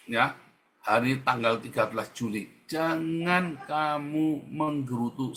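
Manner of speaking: usual speaking voice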